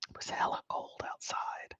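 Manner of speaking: hushed whisper. Real, not acted. Host whispers to the chat about the morning cold. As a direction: breathe it out as a whisper.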